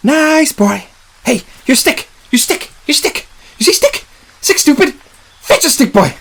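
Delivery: high-pitched coaxing, in short bursts; coaxing a creature. Acted. Man baiting a creature to fetch a stick, high coaxing. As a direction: call out high as if to a dog.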